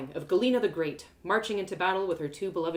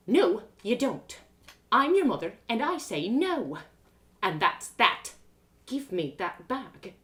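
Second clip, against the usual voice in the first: slow, firm and higher motherly command; stern mum; 3 semitones higher, 3 dB louder, slower than usual. Acted. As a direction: lay down the law. Mum lays down the law.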